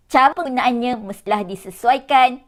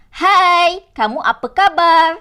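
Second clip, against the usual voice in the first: higher, louder hai across the room; calling out a greeting; 7 semitones higher, 5 dB louder than usual. Shown as a demonstration. Teacher greeting someone to show pupils, higher and louder. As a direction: hail a friend to get attention. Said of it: "untuk menegur seseorang atau menarik perhatian".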